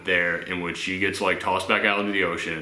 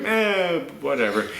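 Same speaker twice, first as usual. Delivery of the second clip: higher-pitched "eh", then settling; dismissive; 5 semitones higher than usual. Retold or imitated. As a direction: shrug it off, unimpressed. Shrugs off beloved characters.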